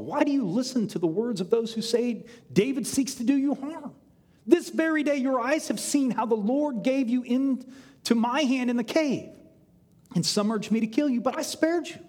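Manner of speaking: high-pitched, earnest protest; impassioned appeal. Acted. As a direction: plead your innocence to the king. David pleads his case to Saul.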